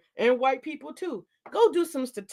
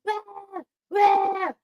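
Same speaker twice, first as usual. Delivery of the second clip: mimicked baby wail; 9 semitones higher, 4 dB louder than usual. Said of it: "She let him scream".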